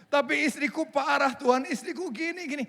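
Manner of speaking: husband whining about his wife, corrected. Pretend. Husband complains again, corrected to Tuhan.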